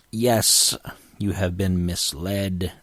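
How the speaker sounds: ordinary talk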